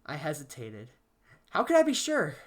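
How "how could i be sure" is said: high, tense anxious question; anxious doubt. Acted. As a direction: let the doubt rise. Paranoid narrator doubts.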